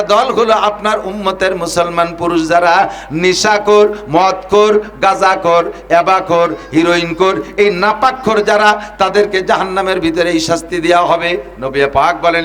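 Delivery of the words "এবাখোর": usual speaking voice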